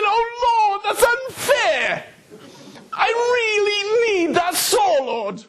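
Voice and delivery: very high, slow wailing plea; hammed-up laughing lament to God; mock anguished prayer. Pastor pretending to beg God for the saw, hammed-up wail.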